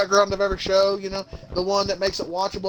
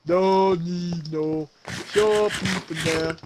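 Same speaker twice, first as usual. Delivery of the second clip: mock tough guy; much slower than usual.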